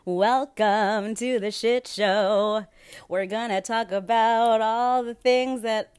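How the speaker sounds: loud, high, drawn-out announcer welcome; grand show host. Acted. Podcaster welcoming listeners to the mess, hammed-up announcer. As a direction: stretch it out like a ringmaster.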